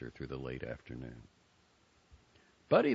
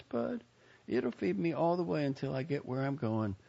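put-on higher girlish voice; lightly voiced young woman; 12 semitones higher than usual. A narrator's reading. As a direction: voice the sister, curious. Joni voiced high.

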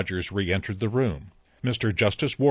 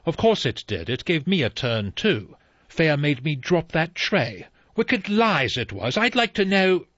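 hurried butler; 7 semitones higher, 3 dB louder than usual. Acted. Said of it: "Rogers said quickly".

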